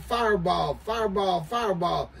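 ordinary delivery

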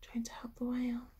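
quick hushed whisper; rushed storytime whisper; fast whisper. Acted. Whispered aside to children.

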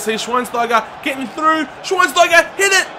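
high yelled urging; excited shouting. Real, not acted. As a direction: yell at him to shoot. Urging a shot.

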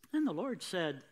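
normal talking voice

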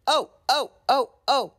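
high, loud squealing cries; screaming trucks. Acted. Trucks jerked off by Thomas, shrieking high and loud. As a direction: shriek as the couplings jerk tight. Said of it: "they screamed".

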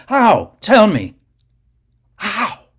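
high, loud demanding cries; a buried man screaming for answers; desperate shouts. Acted. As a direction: yell into the falling soil. Garak shouts buried alive.

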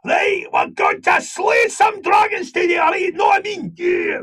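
full-on Glaswegian shout, pitched very high; heavy Glaswegian accent. Retold or imitated. Actor auditioning as a dragon slayer, full-on Glaswegian Scottish. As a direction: give it everything in broad Glasgow Scots. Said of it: "full on heavy sort of Glaswegian Scottish".

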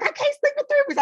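very high excited squeak; giddy squeal. Retold or imitated. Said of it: "I'd be like".

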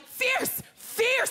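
high, sharp mock shout of praise; fierce cheer. Two guys hyping her up at the bar, high and excited. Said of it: "two other guys are like".

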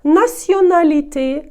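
націоналіте high, loud and slow, every N plain; no nasalisation. Shown as a demonstration. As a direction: spell out націоналіте by sound, with oral vowels. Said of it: "носової вимови також не буде".

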